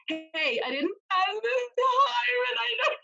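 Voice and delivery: high, strained, tearful voice, slow and loud; retold crying call. Telling her husband she failed, voice cracking into tears. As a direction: squeak it out like you're about to cry. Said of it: "and I started crying".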